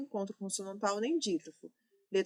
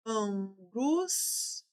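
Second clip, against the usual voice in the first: Angústia stretched slowly, syllable by syllable; drawn out slowly; much slower than usual. Shown as a demonstration. Teacher to class, stretching Angústia slowly to split syllables. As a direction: pull Angústia apart slowly. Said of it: "teacher stretches word to split it".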